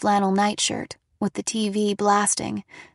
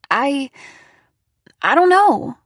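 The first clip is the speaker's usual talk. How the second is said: hesitant, higher, louder stammer; 5 semitones higher, 7 dB louder than usual. A narrator's reading. Daughter questioned by mom about the monster face, stammering. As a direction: stall on the first word, then blurt. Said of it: "I stammered".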